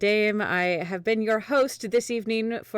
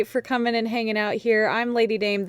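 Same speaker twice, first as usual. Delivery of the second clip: usual speaking voice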